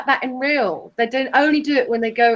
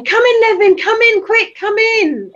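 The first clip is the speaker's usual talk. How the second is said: high, loud eager calling; mock eager welcome; 11 semitones higher, 5 dB louder than usual. Mocking Steve's eager greeting to Nevin, hammed-up. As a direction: tease him by hamming up his eager welcome. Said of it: "you say".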